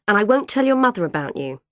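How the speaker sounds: quick, high, loud wheedling; acted bossy promise; patronising bargain. A narrator's reading. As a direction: coax while talking down. Bargaining with Troy in story.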